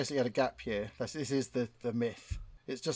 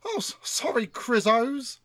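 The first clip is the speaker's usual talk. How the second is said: "Chrisos" said higher as a comedian's quirky drawn-out word; James Acaster impression; 9 semitones higher, 3 dB louder, slower than usual. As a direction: do the comedian's odd take on "Chrisos", pitched up. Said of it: "Impression of James Acaster".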